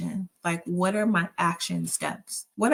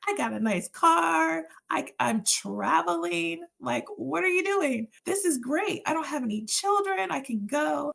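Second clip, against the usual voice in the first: much higher, incredulous protest to God; retold indignant complaint, voice raised high; angry protest; 9 semitones higher than usual. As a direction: pitch it way up in disbelief. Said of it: "I was angry".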